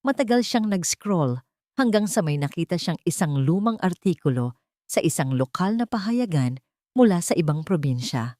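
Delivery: ordinary delivery, nothing marked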